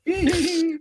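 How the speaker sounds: short high laugh, falling away; high burst of laughter. Real, not acted. To the listener, laughing at annoying the teachers: high bright laugh. Laughing at own school memory.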